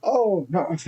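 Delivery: high, gentle granny voice; frail old lady. Retold or imitated. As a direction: coo it like a sweet old lady. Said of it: "And she was like".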